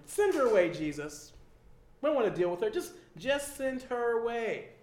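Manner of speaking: loud, pushy plea; mock-impatient disciples pleading; begging disciples. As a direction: urge him to send her off. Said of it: "they're begging him".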